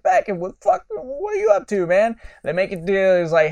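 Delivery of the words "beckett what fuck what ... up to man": much higher, a startled friendly yelp; mock-shocked high-pitched hail; surprised greeting. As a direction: call out in surprise to an old buddy.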